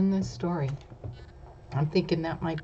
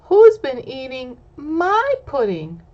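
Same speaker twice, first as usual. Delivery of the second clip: higher, louder little bear voice; Baby Bear asks, higher little voice; 6 semitones higher, 9 dB louder than usual. Acted. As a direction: play the small bear, squeaky and eager. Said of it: "Baby Bear says".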